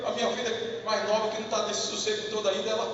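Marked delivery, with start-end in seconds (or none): none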